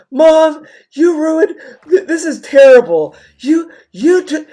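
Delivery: high, whiny mock-crying child; hammed-up, stammering tantrum at mom; so mad. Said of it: "And I was so mad".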